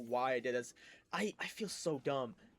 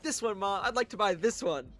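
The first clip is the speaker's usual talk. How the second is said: put-on higher-pitched, cheerful kid; louder, eager little-kid request; all happy; 5 semitones higher, 5 dB louder than usual. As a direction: act happily innocent for mom. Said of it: "I come back all happy".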